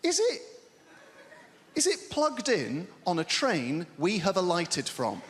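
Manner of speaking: mock quiz guess. Mock guessing game questions.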